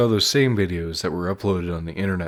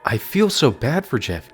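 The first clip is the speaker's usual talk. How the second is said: ordinary delivery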